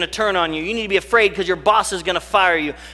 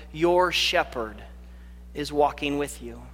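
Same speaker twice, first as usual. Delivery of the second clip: soft reassurance; 4 semitones lower, 7 dB quieter, slower than usual. Real, not acted. Comforting the congregation softly.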